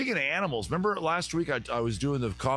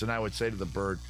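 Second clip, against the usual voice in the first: ordinary talk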